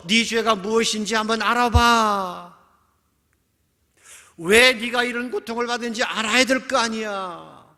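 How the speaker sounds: loud, high scolding; accusing friend. Acted. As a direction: demand that the listener own up. Friends accusing Job in retelling. (높은 목소리로 몰아붙이듯)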